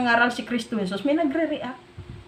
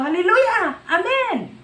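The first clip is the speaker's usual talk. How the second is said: shouting hallelujah; 6 semitones higher than usual. Retold or imitated. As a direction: call it out like a glad worshipper. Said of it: "Kaya may sumisigaw".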